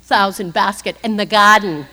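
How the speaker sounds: "garden" said as "gahden" with no r, in a lightly voiced Boston accent; a casual Boston "garden" said at talking level. Telling the AA crowd about Larry Bird, light Boston accent. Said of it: "if the Boston people are here".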